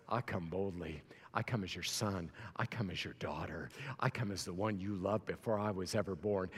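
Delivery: soft, low, hushed prayer, near a whisper; intimate prayer. Acted. Preacher voicing a believer's private prayer, hushed and soft. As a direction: pray quietly as if alone with God.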